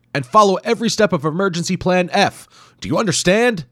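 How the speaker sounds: high, loud instructions; urgent order. Acted. Hero ordering Wimple to follow plan, loud. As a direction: drive it home. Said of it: "Doctor Hayabusa croaked".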